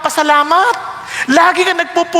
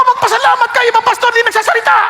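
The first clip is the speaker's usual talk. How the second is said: normal talking voice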